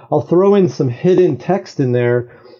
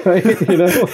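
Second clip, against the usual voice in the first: amused, higher-pitched laughing check-in; 5 semitones higher than usual. Real, not acted. Guest to interviewer about talking to objects, laughing. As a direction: say it through a laugh.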